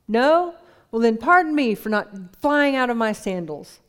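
loud, high mocking sarcasm; sarcastic retort. Peter brushing off Andrew's news, loud and sarcastic. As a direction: throw the sarcasm back at him. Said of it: "Then Peter finally asks him".

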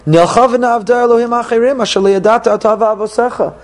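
high, eager pitch to lure someone; excited enticer. Acted. Enticer luring a close relative to follow, eager and excited.

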